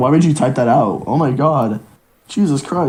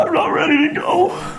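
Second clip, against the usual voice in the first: high teary wail; crying; 12 semitones higher than usual. Acted. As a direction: sob out the line. Character fearing death in skit.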